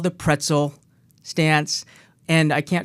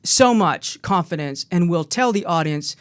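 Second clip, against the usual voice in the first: ordinary delivery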